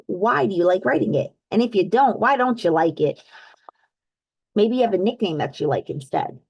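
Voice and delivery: ordinary delivery, nothing marked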